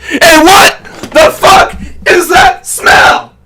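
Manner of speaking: high, strained yell with slow, broken-up words; imitated movie outburst. Hero reacting to a smell, mock strained yell. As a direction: yell it haltingly, mimicking the hero's disgust.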